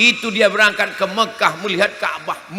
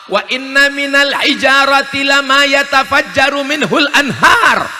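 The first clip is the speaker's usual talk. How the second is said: loud, higher recited Arabic verse; Quran recitation; 9 semitones higher, 8 dB louder than usual. Acted. Preacher reciting scripture to the congregation, loudly proclaimed.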